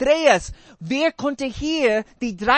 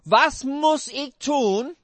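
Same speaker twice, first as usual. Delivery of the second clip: slow emphatic quote; much slower than usual. Shown as a demonstration. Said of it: "was hat dieser junge Mann Jesus genau gefragt".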